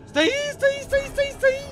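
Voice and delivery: very high, loud panicked repeating; terrified squeal. Real, not acted. Gamer seeing the monster close, terrified squeal. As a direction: squeak in terror.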